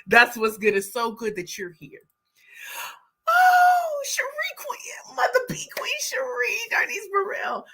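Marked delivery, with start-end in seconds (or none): 3.3-7.7 delighted. Real, not acted. Greeting a viewer on stream.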